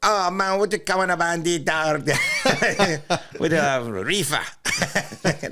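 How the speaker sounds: high, drawn-out mock Jamaican patois; laughing attempt at a Jamaican accent. Shown as a demonstration. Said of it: "Can you give us a little taste?".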